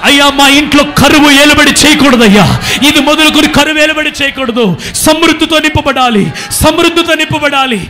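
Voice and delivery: shouted pleading prayer; loud insistent prayer cry; loud fervent prayer. Shown as a demonstration. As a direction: demand it of God with full voice. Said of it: "కన్నీరు విడుస్తూ".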